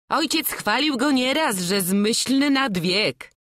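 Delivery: a mother fondly recalling praise. Acted. Mother answers her son.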